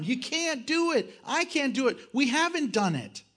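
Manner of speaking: ordinary talk, nothing marked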